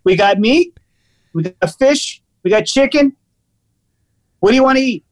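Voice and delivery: lightly imitated waiter, pitch climbing as he lists; aggressive waiter. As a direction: reel off the options like a pushy waiter. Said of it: "Really super aggressive".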